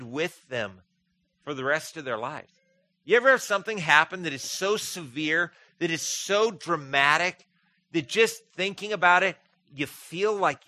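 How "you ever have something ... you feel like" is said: raised, higher preacher's voice pressing the point; preaching at full force. Acted. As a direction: preach it out, louder and higher, overwhelmed by awe.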